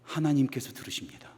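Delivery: breathy unvoiced whisper, very soft. Shown as a demonstration. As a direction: say it as a hushed secret. Repeated line, whispered then loud. (속삭이듯 조용히)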